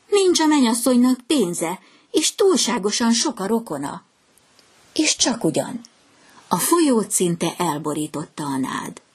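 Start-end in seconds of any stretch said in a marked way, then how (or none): 0.1-4.0 higher, chirpy mocking gossip; chirping swallows. A narrator's reading. Other swallows mock the reed romance, chirpy and higher. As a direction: twitter it out like gossiping birds. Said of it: "csivitelte a többi fecske".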